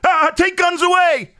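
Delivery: shrill, loud mocking outcry; high, loud sneering mock cry; mock panic. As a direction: parody a hysterical crowd screaming for a ban. Mocking reaction to school shootings.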